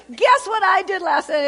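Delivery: put-on high, loud, eager teen voice; excited girl on the phone. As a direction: burst out like a kid with news. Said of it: "And she says".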